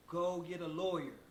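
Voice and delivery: ordinary delivery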